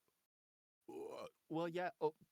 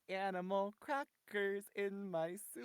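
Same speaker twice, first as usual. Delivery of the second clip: put-on slow, higher child-star voice; Shirley Temple; 4 semitones higher, 5 dB quieter, slower than usual. Shown as a demonstration. Guest requests a line from co-guest, Shirley Temple voice. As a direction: draw it out in a playful high sing-song. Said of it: "Okay, okay, Shirley Temple".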